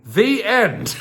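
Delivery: loud, high, announced ending; emphatic sign-off. A narrator's reading. Reader closing the baffling tale, emphatic sign-off. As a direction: punch out the final words with a laugh breaking in. Loud mock-grand finish.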